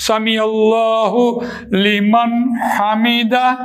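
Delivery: سمیع اللہ لمن حمیدہ drawn out very slowly; prayer recitation. Shown as a demonstration. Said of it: "demonstrates izhar in prayer phrase". (نماز کا کلمہ آہستہ ادائیگی سے)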